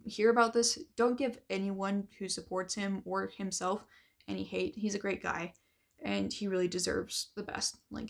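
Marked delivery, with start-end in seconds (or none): none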